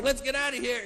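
lightly voiced impatient newborn; hasty newborn. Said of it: "even when he was born".

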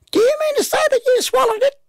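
very high-pitched, astonished mum; stunned Mum. A narrator's reading. Mum stunned that her boy swallowed it, very high. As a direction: squeak the question out in a very high voice.

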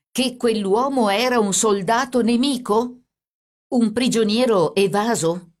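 high, loud, slow emphatic questioning; drawn-out, insistent accusing questions; stern accusation. A narrator's reading. Carabiniere presses Caterina with accusation.

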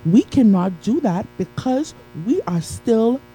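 usual speaking voice

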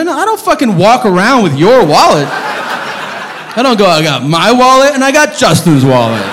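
exasperated rant. Acted. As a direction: protest loudly at an absurd question. Indignant retort to the waiter.

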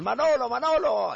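high, loud, drawn-out name call; stretched-out shout. Acted. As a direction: holler slowly for someone in the queue. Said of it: "le empiezan a gritar otra vez".

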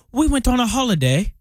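a deliberately bad British accent for holiday; a mock British accent, on purpose badly done; terrible British accent. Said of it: "That was a terrible British accent".

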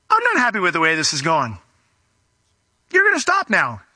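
stern scolding, pitch jumping up on the command; strong disapproval. Acted. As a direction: rebuke them and order a stop. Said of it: "It means to express strong disapproval".

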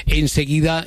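usual speaking voice

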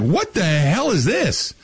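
high, drawn-out mock disbelief; incredulous outrage. A diner appalled by wings with feathers still on, incredulous. Acted customer reaction to bad wings.

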